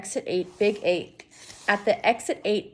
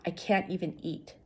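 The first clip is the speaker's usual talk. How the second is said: ordinary delivery, nothing marked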